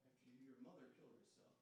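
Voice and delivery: ordinary delivery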